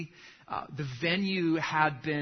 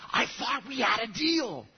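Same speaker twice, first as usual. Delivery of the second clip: higher, tense hissed complaint; 6 semitones higher than usual. Acted. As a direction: hiss it like a betrayed groom. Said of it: "the groom hissed".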